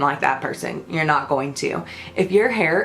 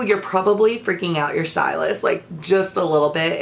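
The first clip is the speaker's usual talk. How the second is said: ordinary delivery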